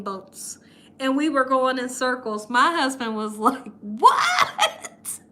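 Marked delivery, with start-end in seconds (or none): 3.8-5.2 high, drawn-out rising cry; hammed-up disbelief, stretched high; mock shocked yell. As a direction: imitate a startled husband, pitch shooting up. Husband's shock as ride takes off.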